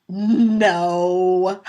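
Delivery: loud, drawn-out no, imitating a boy; disbelieving son. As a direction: stretch out a small boy's horrified no. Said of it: "he looks at me and he goes".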